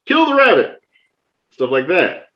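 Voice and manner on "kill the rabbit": jokey high put-on voice, tossed off for fun; playful silly voice. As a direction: clown around with a squeaky cartoon line. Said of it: "I'll just play around and be like".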